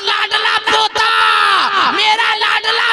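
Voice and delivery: proclaiming shout. Retold or imitated. Amina announcing the birth to the grandfather, shouting. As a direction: ring out the joyful news. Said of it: "आमना खातून फरमाती है".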